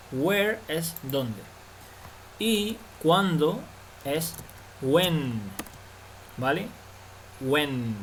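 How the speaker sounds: Where es dónde drawn out slowly, word by word; slow teaching. Shown as a demonstration. Teacher slowly repeats the correction for students. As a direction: spell it out slowly for learners. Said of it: "slow teaching model".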